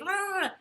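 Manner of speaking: high, loud burst of garbled noise; excited babble noise. Shown as a demonstration. Host mocking their own fast talking, excited babble noise. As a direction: imitate your own overexcited gabbling. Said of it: "I get excited and be".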